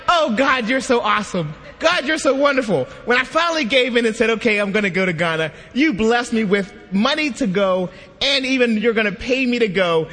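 high-pitched gushing thanks to God; hammed-up grateful gushing; excited, happy praise. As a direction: retell an overjoyed prayer, punching each praise. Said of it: "I'm so excited and so happy".

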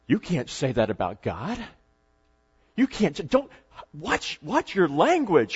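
halting, hushed mock shock; scandalized women. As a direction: stammer as if scandalized. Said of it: "all the women around her went like this".

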